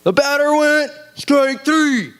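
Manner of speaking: high, slow, drawn-out umpire call; mock umpire call. Youth pastor mocks the umpire's bad call, high and slow. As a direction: ham up the umpire's ruling. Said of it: "this umpire decided to say".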